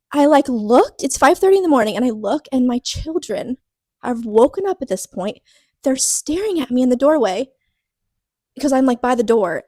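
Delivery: pushing through the urge to cry; holding back tears. Real, not acted. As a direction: near tears while retelling the worst morning. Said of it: "oh my gosh, I'm not gonna cry".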